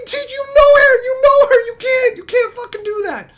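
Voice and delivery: very high, loud squealed protest, tailing off in volume; outraged disbelief. Acted. Returns to outrage at beaver.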